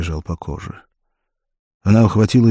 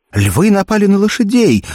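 high, loud shout of alarm; shouted warning, voice leaping up; alarmed cry; 14 semitones higher, 6 dB louder than usual. A narrator's reading. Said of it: "закричала Валерия".

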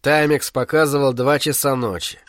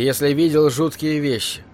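usual speaking voice

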